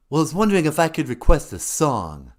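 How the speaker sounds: prank caller pretending to be a rock star. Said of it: "It's me, [name] of Kiss".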